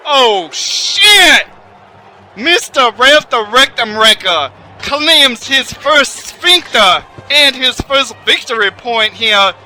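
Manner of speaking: loud, high commentator outburst; excited shout. Acted. Wrestling commentator roaring at the big slam, loud excited shout. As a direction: yell it like the ringside call just blew up.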